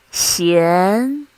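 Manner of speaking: Xian gliding upward; second tone. Shown as a demonstration. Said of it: "this is how we mark the tones".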